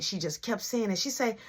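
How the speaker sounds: ordinary talk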